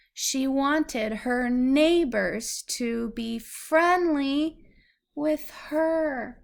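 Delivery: clear, measured storybook reading; reading to a child. Acted. Parent reads a picture book to a child, gentle storybook reading. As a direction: read it out slowly for a little listener.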